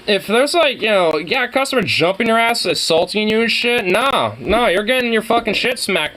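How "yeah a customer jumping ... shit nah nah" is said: usual speaking voice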